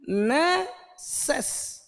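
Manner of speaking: ने सेस said very slowly, split apart; broken into syllables. Shown as a demonstration. Teacher splits the word for students, very slow. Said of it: "ऐसे तोड़ के बोलो".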